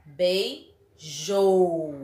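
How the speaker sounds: "Beijou" drawn out, bei, then a long JOU; stressed syllable demo. Shown as a demonstration. Teacher asks attention to pronunciation, "Beijou" split with stretched JOU. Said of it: "Ó, atenção à pronúncia".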